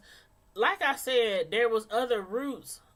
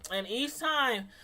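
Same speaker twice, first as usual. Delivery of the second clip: ordinary delivery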